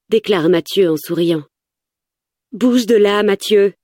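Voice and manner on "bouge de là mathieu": higher-pitched urgent insistence; insistent. A narrator's reading. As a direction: urge him to move, pitch raised. (insistant, voix plus aiguë)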